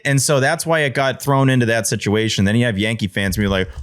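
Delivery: ordinary talk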